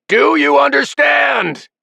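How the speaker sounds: slow, very high, loud shout; bellowing. A narrator's reading. Father bellows final warning at sons, furious shout. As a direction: roar each word out. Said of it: "he bellowed".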